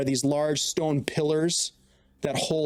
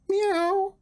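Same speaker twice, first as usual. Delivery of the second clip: high cat meow; kitten voice. Acted. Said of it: "You're gonna do the cat, the cat call".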